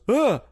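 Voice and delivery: short, high-pitched mock jump-scare noise; quick pretend fright noise; startled grunt. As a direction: imitate an audience jolting at a cheap scare. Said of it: "everyone around you goes".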